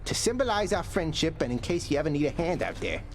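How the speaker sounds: excited, high-pitched character voice; overjoyed. Acted. Thanking a pal for the rare bottle, overjoyed.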